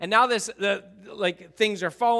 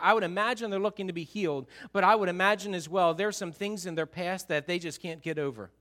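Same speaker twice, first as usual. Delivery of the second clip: usual speaking voice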